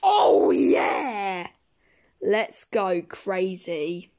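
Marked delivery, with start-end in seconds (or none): 0.0-1.5 loud yell of delight; wild cheer. Acted. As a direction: belt it out on a sugar rush. Sugar rush cheer.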